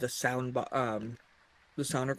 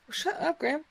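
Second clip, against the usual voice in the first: playful shut up; 13 semitones higher than usual. Real, not acted. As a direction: tell a friend to hush, teasing. Playfully silencing a friend.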